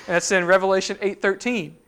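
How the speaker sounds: ordinary delivery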